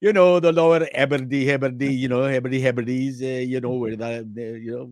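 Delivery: laughing through a put-on Scottish accent; fake Scottish accent. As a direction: ham up a Scottish accent while giggling. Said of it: "talking like this to her".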